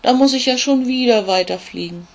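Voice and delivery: high, loud storybook whine; whiny complaint. Acted. Tired ladybug complains to the raven, high and whiny. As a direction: moan about flying on, pitched high. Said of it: "jammert Olli".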